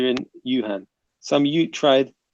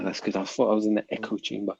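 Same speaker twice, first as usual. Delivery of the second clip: ordinary talk, nothing marked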